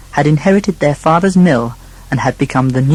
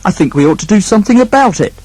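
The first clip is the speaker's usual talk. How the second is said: determined brother; 6 semitones higher, 4 dB louder than usual. Acted. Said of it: "declared the eldest son".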